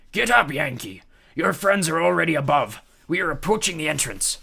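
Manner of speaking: loud rough captain voice; ghost captain. A narrator's reading. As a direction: rouse him like a ghost captain.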